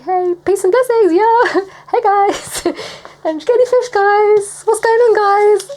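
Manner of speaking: high, loud, chirpy greeting; hammed-up friendly call to the guys; playful cheery Jesus. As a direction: pop up with a bouncy hello, giggling. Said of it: "he just pops up and he's like".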